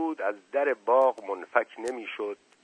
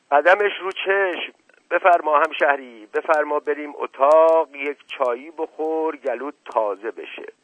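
higher, louder welcoming host; hospitable Mashqasem; 6 semitones higher, 7 dB louder than usual. A narrator's reading. Mashqasem invites his townsman in for tea, hospitable and louder. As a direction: play an eager host ushering a guest in.

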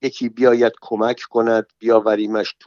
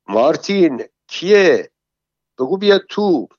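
calling out from a party; 6 semitones higher than usual. A narrator's reading. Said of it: "یکی داد زد".

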